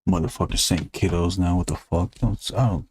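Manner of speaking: ordinary talk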